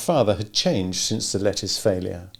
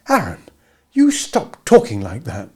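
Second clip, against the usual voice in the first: stern; 4 semitones higher, 5 dB louder than usual. A narrator's reading. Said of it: "Abra said sternly".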